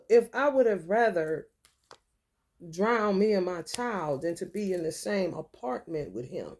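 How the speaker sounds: usual speaking voice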